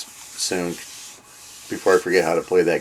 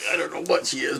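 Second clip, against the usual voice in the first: ordinary talk, nothing marked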